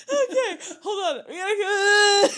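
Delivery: high, soft, helpless giggling speech; crying with laughter. Real, not acted. Co-host trying to get the show moving, crying with laughter. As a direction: try to pull it together through the laughter. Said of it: "I'm literally crying".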